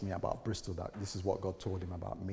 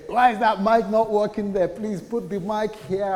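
loud, much higher, fussy demand; bossy complaint; 11 semitones higher, 12 dB louder than usual. Retold or imitated. Pastor scolding helpers about the mic, loud and fussy.

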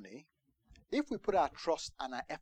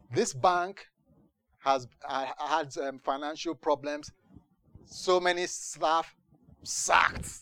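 loud, emphatic imitation of a news bulletin; lightly imitated news report, raised volume; mock news announcement; 7 dB louder, slower than usual. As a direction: announce shocking news forcefully. Acts out shock news announcement.

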